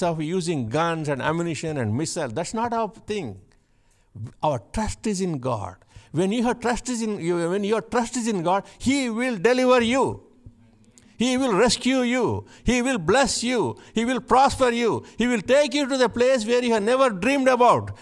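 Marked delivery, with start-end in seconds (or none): 6.1-18.0 high, loud, building sermon cry; fiery preaching. Acted. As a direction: preach it out to the whole church at full force. Preaching with rising force.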